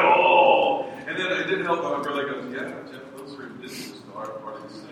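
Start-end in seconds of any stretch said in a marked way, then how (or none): 0.0-0.8 put-on horrified kid. Second grader lying awake after the scary movie, mock horror. 2.5-4.9 low, quick, soft deadpan; teasing brother. Retold or imitated. Older brother scares a second grader about bugs, deadpan teasing.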